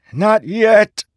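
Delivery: high-pitched, strained scream. A narrator's reading. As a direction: scream it in desperate defiance. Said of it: "he screamed".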